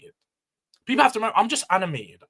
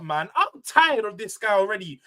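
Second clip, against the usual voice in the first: ordinary talk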